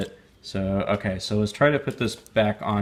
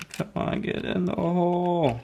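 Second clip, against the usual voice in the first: coaxing; 9 semitones higher than usual. Real, not acted. Coaxing a tiny part in.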